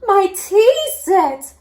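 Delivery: high, slow squeak. A narrator's reading. Gran squeaks over her broken tea set. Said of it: "squeaked Gran".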